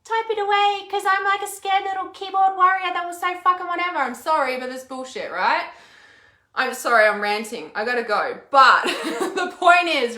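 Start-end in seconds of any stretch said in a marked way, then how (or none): 0.0-4.0 high, loud sneering troll voice; mock the coward behind the screen; mocking keyboard warrior. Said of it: "but they're like". 8.5-10.2 laughing. Real, not acted. Laughs off her own rant.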